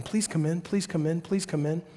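soft, repeated father's plea; gentle pleading. Acted. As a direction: coax him softly, without pushing. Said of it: "pleads with him".

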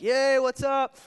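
high, loud forced cheer; fake cheerful greeting. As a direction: put on a bright front for the boss. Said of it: "like, super".

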